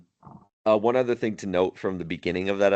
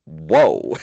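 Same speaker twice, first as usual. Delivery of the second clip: retold astonishment; 4 semitones lower, 7 dB louder than usual. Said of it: "shows everybody being like".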